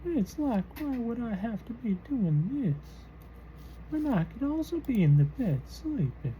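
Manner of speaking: put-on high old man voice. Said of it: "Me getting down here in this voice".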